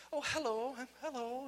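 a Nashville speaker's hammy try at British; lilting, badly faked British accent; attempted British accent. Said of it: "that's Nashville trying to do Britain".

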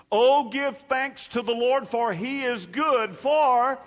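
high, loud call-and-response lead; rousing scripture proclamation. Real, not acted. Preacher leads congregation in thanksgiving psalm, proclaiming loudly.

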